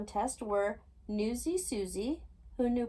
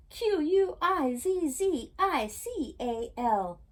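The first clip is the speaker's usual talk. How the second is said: slow, letter by letter, a bit louder; shouting corrected spelling; 6 semitones higher, 5 dB louder, slower than usual. A narrator's reading. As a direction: spell it out loud, slowly. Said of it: "Oops, I spelled that wrong. Let me read that again".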